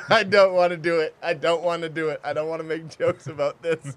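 giggling through the words; laughing. Real, not acted. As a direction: laugh while protesting. Laughing refusal to joke.

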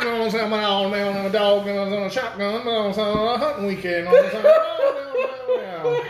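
pluck the no's like banjo strings in a thick Missouri accent; escalating mock Missouri accent, cracking up at the end. Shown as a demonstration. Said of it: "it just sounds like banjo".

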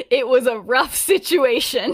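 loud, laugh breaking through the quote; laughing retelling. Retold or imitated. James downplaying the rescue to Lily, laughingly retold. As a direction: burst through the excuse laughing.

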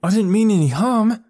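high, loud pleading protest; pleading cry. A narrator's reading. Miles pleads with the angry men, high and loud. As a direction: beg not to be blamed. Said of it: "cried Miles".

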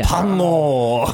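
a loud, drawn-out call of the name; loud playful callout. Real, not acted. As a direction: announce it like a race caller. Calling out a horse name.

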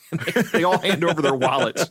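words broken up by real laughter; giggling your way through the line; laughing. Real, not acted. Podcast host cracking up at his own Simpsons story, laughing.

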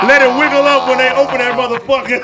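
laughing through the joke; can't keep a straight face; laughing while talking. Real, not acted. Joking about an arm left in the box, laughing.

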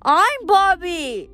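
put-on high, very slow child voice; high slow kid protest; protesting little boy. A narrator's reading. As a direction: insist like an offended little kid. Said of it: "the little boy protested".

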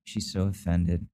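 ordinary talk, nothing marked

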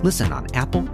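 ordinary delivery

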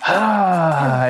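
big gulp of air let out with "ah"; deep breath. Acted. Game master acting out players surfacing for air, deep breath. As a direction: gasp in, then a long exhale. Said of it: "You take deep breaths".

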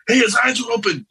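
high, startled shout of discovery; startled cry. Retold or imitated. Friend noticing his open eyes, startled high yell. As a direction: blurt it out in shock.

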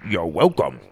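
talking through a mouthful; mouth full. Acted. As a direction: say it round a mouthful of mosquito. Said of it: "Tim said with a mouthful".

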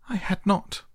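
high, sharp angry cry. A narrator's reading. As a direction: snap it back angrily. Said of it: "cried the mouse sharply and very angrily".

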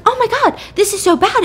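high-pitched retold panic; acted alarm. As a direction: voice jumps up in mock alarm. Retold panic at second scream.

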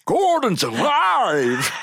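very slow, high bellow; Brian Blessed. Acted. Quoting the hawk man, huge drawn-out triumphant roar. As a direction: roar it with jaw unhinged. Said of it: "Brian Blessed unhinges his jaw".

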